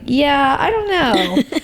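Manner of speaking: high, drawn-out mock uncertainty; imitated clueless shrug. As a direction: imitate someone with no idea, stretching it out. Imitating the unsure barista's reply.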